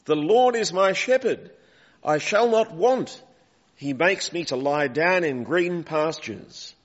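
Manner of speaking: reciting scripture. Retold or imitated. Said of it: "common to the Old Testament".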